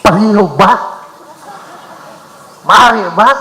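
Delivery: a request that sounds like a command; demanding tone. Shown as a demonstration. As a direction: bishop showing a demand disguised as please. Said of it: "the tone of our voice does not say we are making a request".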